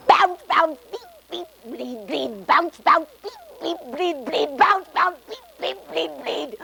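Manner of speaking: high, bouncy beeps and bleeps; gadget noises. Retold or imitated. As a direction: chirp the noises her gear makes. Imitating her clanking gear.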